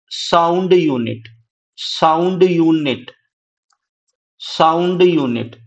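slow, spaced repeats of sound unit for notes; slow dictation. Shown as a demonstration. Teacher drilling a term for students, slow and spaced. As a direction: drill sound unit slowly, one word at a time. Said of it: "teacher repeats key term".

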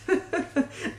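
a short high soft laugh; breathy laugh. Real, not acted. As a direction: let a little surprised laugh slip. Overcome by a sweet note.

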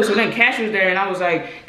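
usual speaking voice

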